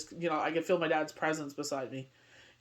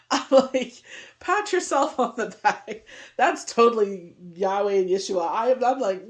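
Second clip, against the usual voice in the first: laughing joy; 3 semitones higher, 5 dB louder than usual. Real, not acted. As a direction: giggle through it, proud of yourself. Laughing, praising herself.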